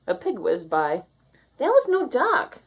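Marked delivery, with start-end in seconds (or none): 1.6-2.6 high loud retort; shout. A narrator's reading. Moo shouting back about the pig, amazed. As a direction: shout it back over the noise. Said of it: "shouted Moo".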